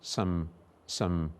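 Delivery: "some" reduced to a weak schwa; schwa demo. Shown as a demonstration. Teacher showing the weak schwa. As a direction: barely voice the vowel in "some". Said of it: "vowel schwa there".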